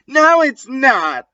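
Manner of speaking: high hammed-up denial; mock angry shout. Ebony shouts at Vampire, high and mock angry. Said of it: "I shouted angrily".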